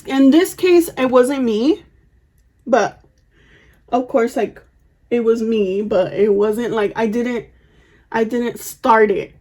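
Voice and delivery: normal talking voice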